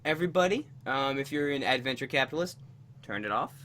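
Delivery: soft low meditation voice; guided meditation. Acted. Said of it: "usual tradition of a guided meditation".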